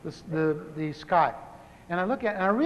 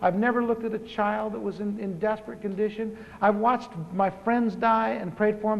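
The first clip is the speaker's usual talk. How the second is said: ordinary delivery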